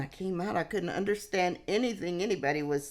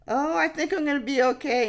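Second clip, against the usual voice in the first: much higher, fast, breezy; brushing it off; 10 semitones higher, 4 dB louder, faster than usual. Retold or imitated. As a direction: wave off the worry brightly. Brushing off offer of paramedics.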